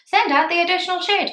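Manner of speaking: mock greedy chorus yell; eager crowd shout. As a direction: shout it like a crowd that wants the extra. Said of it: "everyone kind of went".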